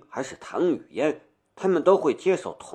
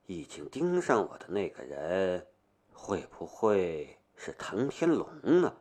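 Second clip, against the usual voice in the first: soft private thought, much quieter; inner thought; 5 semitones lower, 8 dB quieter than usual. Acted. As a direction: think it quietly to yourself. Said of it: "他的心里暗暗的道".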